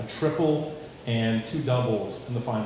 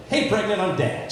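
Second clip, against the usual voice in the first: higher, proud goofy dad; cheesy dad joke; 7 semitones higher, 4 dB louder than usual. Retold or imitated. Dad cracking a dad joke at daughter, higher goofy. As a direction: land the bad joke brightly. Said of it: "And I was like".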